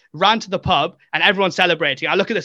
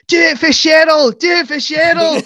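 high, loud Scottish-woman shriek; screaming Scottish woman; 11 semitones higher, 6 dB louder than usual. Retold or imitated. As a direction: imitate a frantic fan screaming at penalties. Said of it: "a Scottish woman just screaming".

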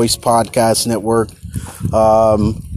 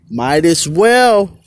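slow, louder "well" ringing out; slow emphatic echo; 7 semitones higher, 4 dB louder, much slower than usual. Real, not acted. Telling listeners to enjoy California, slow and emphatic. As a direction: drawl it out for emphasis.

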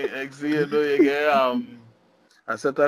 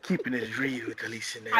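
lower, softer seductive radio voice; 6 semitones lower, 11 dB quieter, faster than usual. Acted. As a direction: purr it like a sultry host. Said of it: "put on the kind of seductive".

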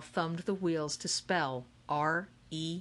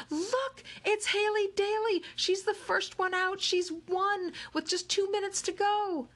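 excited crowd screaming. Acted. Crowd fan screaming Haley has won, high excited yell.

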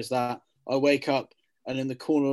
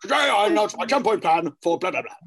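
raised, louder pompous politician voice; Boris Johnson impression; 6 semitones higher, 7 dB louder than usual. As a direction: bluster like a prime minister. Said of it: "an impression of him".

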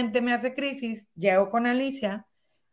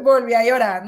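ordinary talk, nothing marked

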